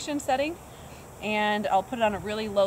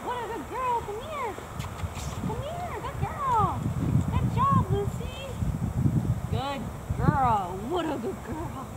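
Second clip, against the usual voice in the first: dog praise voice; 10 semitones higher, slower than usual. Real, not acted. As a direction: coo praise up high to a shy dog. Trainer praising shy dog Lucy.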